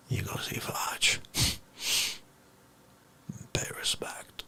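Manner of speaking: soft whispered instruction. Acted. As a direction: hush it like a secret order. Hushed instruction.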